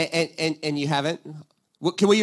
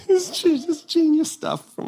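slow, higher and laughing; laughing delight; 9 semitones higher, much slower than usual. Real, not acted. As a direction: savour it with a laugh. Delighted admiration.

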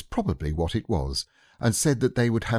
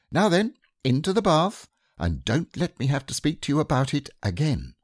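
higher, firm motherly scolding; 6 semitones higher than usual. Acted. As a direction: warn a naughty child. Kanga scolds.

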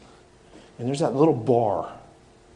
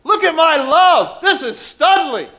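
very high, very loud slow bragging; boasting to God about his work; hammed-up boast; 13 dB louder, slower than usual. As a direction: show off like a proud kid.

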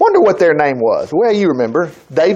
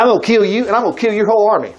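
rushed defiant boast; 2 semitones higher, much faster than usual. Retold or imitated. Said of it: "he said".

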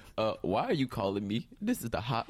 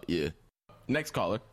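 usual speaking voice

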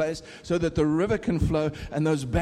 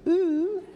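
mock ooh; 13 semitones higher than usual. Mocking the reserved British crowd.

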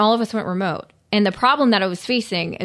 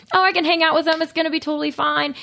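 high, airy self-mocking quote; naively breezy; 8 semitones higher than usual. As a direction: imitate your own naive confidence in a higher voice. Voicing her naive past thought.

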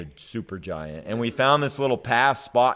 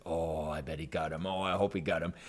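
hushed hope; 5 semitones lower, 9 dB quieter than usual. Retold or imitated. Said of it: "And I said".

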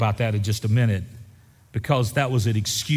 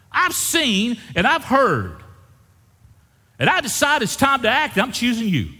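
preaching at full force; 6 semitones higher than usual. Acted. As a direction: preach it with rising fire. Preacher voicing God's call.

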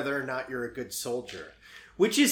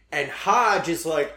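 ordinary delivery

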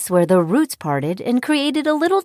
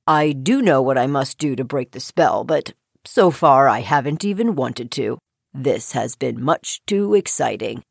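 low, contented frog; deep-voiced, unbothered frog; 10 semitones lower than usual. A narrator's reading. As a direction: explain calmly in a deep voice. Frog contentedly explains his spell.

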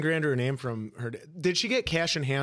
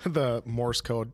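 usual speaking voice

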